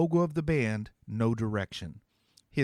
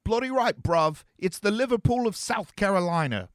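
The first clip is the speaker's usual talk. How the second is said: high, loud put-on British accent, called out; teen calling back in an overdone British accent; fake British accent; 9 semitones higher, 6 dB louder than usual. A narrator's reading. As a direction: ham up a cheesy British accent from the shadows. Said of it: "The voice from the shadows called back".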